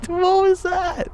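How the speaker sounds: laughing high and slow. Real, not acted. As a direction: laugh through the words. Laughs while quoting himself.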